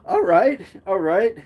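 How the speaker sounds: high, rising "all right"; retold reaction. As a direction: lift it up like a pleasantly startled reaction. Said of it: "I was like".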